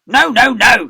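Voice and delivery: furious teacher booming no; thundering. Acted. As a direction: thunder it at the girl. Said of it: "he thundered".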